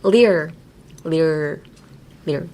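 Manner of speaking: "lear" repeated with a quick tongue flick; pronunciation drill. Shown as a demonstration. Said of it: "lear って難しいですね".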